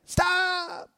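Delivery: high, strained cry of stop; retold yell. Pastor reliving yelling at the kids, high strained cry. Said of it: "I see it in my head".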